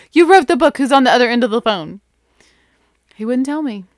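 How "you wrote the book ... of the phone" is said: louder and higher, indignant; stamping-the-foot indignation; indignant outburst. Retold or imitated. Fan demanding an answer from the author, louder and indignant. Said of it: "just about stamped my foot".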